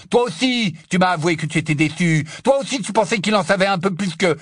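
loud, high finger-pointing tirade; angry accusation. Acted. Ron accuses Hermione of sharing his disappointment, angry. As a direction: pile up the charges without letting her in.

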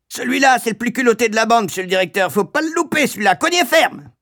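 shrill, very high bawling; madame Pratchett. A narrator's reading. Woman urging a hard beating, shrilly bawling. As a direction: bray it in a horrible piercing voice. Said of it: "voix suraiguë de madame Pratchett qui braillait".

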